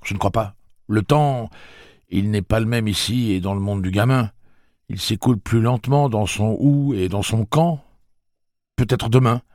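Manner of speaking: voix haute, plus forte; Eddie hésitant. Acted. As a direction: cherche ses mots, anxieux. Voix d'Eddie. (Eddie répond à Roland, incertain)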